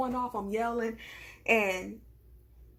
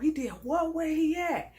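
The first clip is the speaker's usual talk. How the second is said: imitating a confused, startled man, pitched up; acting startled; 6 semitones higher, 4 dB louder than usual. Melvin hearing she was followed, acting startled. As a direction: react with high-pitched, put-on disbelief. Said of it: "he's acting startled".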